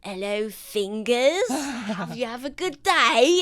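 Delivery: slow, lightly voiced doorkeeper greeting; a hint of a gatekeeper. Doorkeeper greeting Fingers, lightly voiced. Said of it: "the voice on the other side goes".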